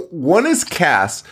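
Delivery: usual speaking voice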